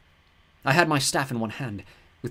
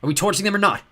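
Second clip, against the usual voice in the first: loud, higher urgent question; 9 semitones higher, 8 dB louder than usual. A narrator's reading. Walt urges her to decide, loud and urgent. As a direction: press for an answer now.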